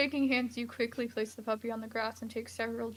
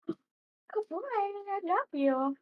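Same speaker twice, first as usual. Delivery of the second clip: high, very slow doting dog talk; baby talk to a dog; 9 semitones higher, much slower than usual. Pretend. Player cooing to a virtual dog, high and very slow. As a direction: coo at the dog like it's your own pet.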